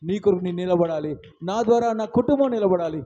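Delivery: pleading, earnest prayer voice; fervent prayer. Acted. Preacher praying before the church, pleading. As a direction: pray aloud with rising fervour.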